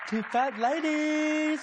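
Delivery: slow, high sing-song number call; bingo caller. Acted. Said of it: "call the bingo in drag?".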